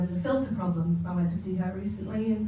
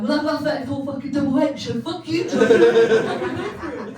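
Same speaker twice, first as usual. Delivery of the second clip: louder, higher defiant snap; hammed-up indignant retort; mock outrage; 5 semitones higher, 8 dB louder, slower than usual. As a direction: fire it back with mock fury. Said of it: "I was like".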